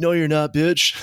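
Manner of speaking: heated comeback, acted for laughs; retold taunt. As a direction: throw back a cocky retort, playing it up. Teller taunts back, retold quarrel.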